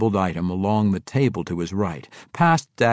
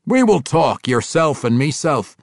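loud, higher-pitched dwarf king; firm, loud dwarf dialogue; 7 semitones higher, 7 dB louder than usual. A narrator's reading. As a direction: speak up as a forceful dwarf king. Dwarf king speaks in story.

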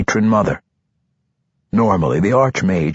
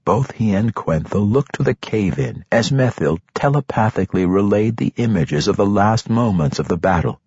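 plain reading voice

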